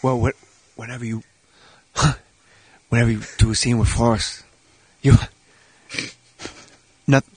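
low, halting, mumbled impersonation; Forrest Whitaker. Acted. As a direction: stall and restart in a low voice. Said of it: "What does, uh, Forrest have to say".

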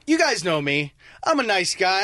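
ordinary talk, nothing marked

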